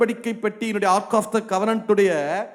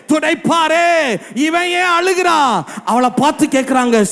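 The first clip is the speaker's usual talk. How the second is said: fervent preaching; 3 semitones higher, 3 dB louder than usual. Acted. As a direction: preach with rising force. Preaching at full force.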